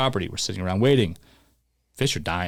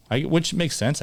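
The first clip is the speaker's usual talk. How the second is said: ordinary talk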